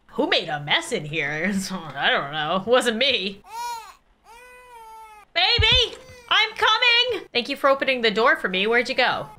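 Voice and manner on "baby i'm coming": put-on high singsong call to baby. Calling to baby in another room.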